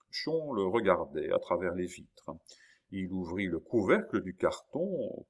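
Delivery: plain reading voice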